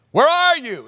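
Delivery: loud, high-pitched cry of "where are you"; calling out to God. Acted. Believer in crisis to a sleeping God, high desperate shout.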